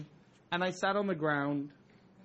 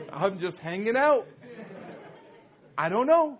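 flustered protest; 4 semitones higher, 8 dB louder than usual. Retold or imitated. Nervous teen protesting to the crying host, high and loud. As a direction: voice jumps higher and louder in panic.

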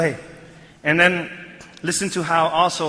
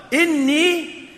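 "Inni" held long and high; emphatic; 9 semitones higher than usual. Shown as a demonstration. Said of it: "that's another tawqeed or another emphasis".

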